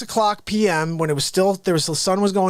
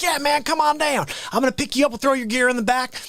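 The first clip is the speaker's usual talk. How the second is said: higher-pitched imitated Southern buddy; Southern accent; 6 semitones higher than usual. Buddy offering the musician a lift, imitated Southern accent. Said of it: "like, Southern accent".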